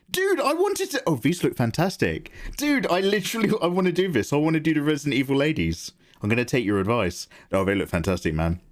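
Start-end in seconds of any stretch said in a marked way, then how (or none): 0.1-5.9 high-pitched eager gushing; excited outburst, high and tumbling over itself; really enthusiastic. Real, not acted. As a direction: gush with real enthusiasm, pitch jumping up. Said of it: "I was really enthusiastic about that".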